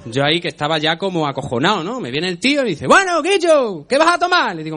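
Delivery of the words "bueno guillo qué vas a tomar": loud, high, drawn-out Andalusian caricature; Andalusian waiter. Acted. Lolailo waiter takes the order, boisterous Andalusian shout. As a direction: belt it out in a hammed-up Andalusian accent. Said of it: "Lolailo andaluz con el pelo rizado".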